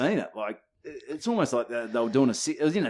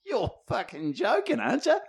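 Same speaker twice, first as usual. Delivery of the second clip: incredulous mate; 10 semitones higher, 4 dB quieter than usual. Retold or imitated. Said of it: "I just hear Fibber go".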